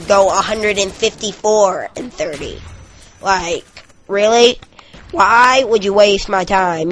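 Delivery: loud, jeering internet troll rant; mock the gamer like a troll. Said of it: "I hope you like my troll voice right there".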